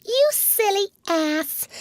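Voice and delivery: tiny, very high fairy, slow insult; drawn-out high-pitched fairy jeer. A narrator's reading. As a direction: squeak it out slowly like a cross little fairy. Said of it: "Tink replied in these words".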